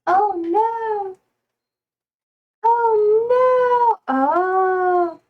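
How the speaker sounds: high, drawn-out dismayed wail; hammed-up, high-pitched dismay; mock dismay. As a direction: wail it in playful horror. Mock dismay at chat messages.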